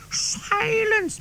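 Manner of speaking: sharp high mock shout. Teacher silencing class, sharp high mock shout. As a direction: snap out a teacher's mock command. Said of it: "Miss Harper shouts".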